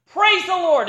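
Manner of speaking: high, loud happy call; delighted shout. Retold or imitated. Rider delighted, urges faster.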